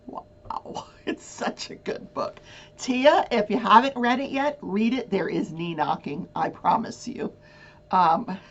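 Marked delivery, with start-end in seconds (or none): 0.0-2.4 breathy whisper, awed; whispered gush. Real, not acted. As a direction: whisper it like a secret. Gushing over steamy book.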